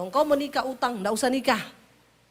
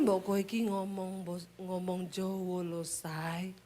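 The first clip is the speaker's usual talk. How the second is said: slow, much softer Javanese "Ojo nesu"; calm him down, lower and softer; soft Solo husband; 5 semitones lower, 10 dB quieter, slower than usual. Retold or imitated. Husband soothing his wife, soft slow Javanese. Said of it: "Berulang-ulang tiap hari".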